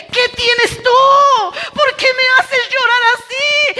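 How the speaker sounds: high, fervent retelling of an emotional exchange; impassioned preaching. Retold or imitated. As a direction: preach the dialogue at a high, urgent pitch. Preaches a retold tearful exchange. (prédica apasionada en tono alto)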